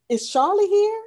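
high, loud rising question; mock timid question. Host voices the mother asking her son for Charlie, high-pitched question.

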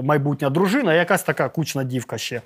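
normal talking voice

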